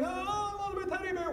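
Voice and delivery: high, soft, hammed-up crying kid; mock wailing child. Little girl mourns her lost teddy, mock wailing. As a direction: wail it like a little girl in tears. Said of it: "She's bellowing".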